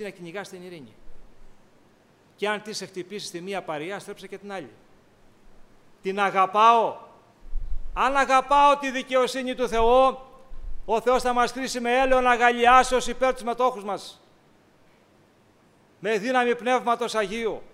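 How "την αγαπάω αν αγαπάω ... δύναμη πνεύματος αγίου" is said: louder fervent sermon voice; preaching. Acted. Preacher lifting his voice about God's justice, fervently. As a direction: lift it to the congregation.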